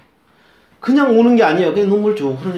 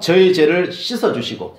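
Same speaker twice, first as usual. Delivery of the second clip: slow, firm prayer line to be echoed; slow prayer call; 2 semitones higher, 7 dB louder than usual. Shown as a demonstration. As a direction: lead it out loud, slowly. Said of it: "한번 따라해 보실까요?".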